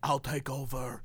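low, soft mock Russian accent; imitated Russian commercial actor. Actor in Call of Duty commercials, imitated low Russian accent. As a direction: do a Russian movie heavy, low-voiced. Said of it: "he was the guy in the Call of Duty commercials that was like".

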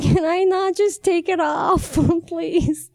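high, comic whiny plea; pleading. Retold or imitated. Patient begging paramedics to spare her leggings, pleading. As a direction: beg in a high mock whine.

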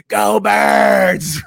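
loud, high mock stadium yell; imitated fan scream. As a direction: yell it like a rabid young fan. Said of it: "as loud as he can".

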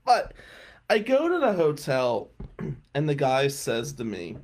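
ordinary talk

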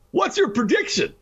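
high-pitched mock fan voice; eager questioner. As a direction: imitate a fan pestering for predictions. Acts out fans pestering for predictions.

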